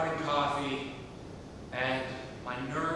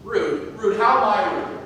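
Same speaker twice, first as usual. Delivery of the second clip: louder and higher, offended and incredulous; indignant outburst; 5 semitones higher, 9 dB louder than usual. Acted. To the stranger who called him rude, indignant loud protest. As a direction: bristle at being called rude.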